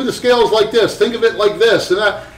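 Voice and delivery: matter-of-fact master giving a lesson; imitating Mike Malone. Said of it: "he's like".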